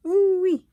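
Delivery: hot-hands squeal; high pained ooh-wee. Real, not acted. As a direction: yelp of heat pain. Hands burnt on iron.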